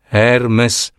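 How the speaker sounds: plain reading voice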